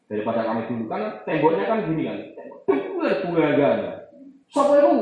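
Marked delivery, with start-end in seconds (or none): 2.7-4.9 high, loud mock call of who's there; hammed-up alarmed adult; startled shout. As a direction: call out sharply as if catching a culprit. Retelling being caught.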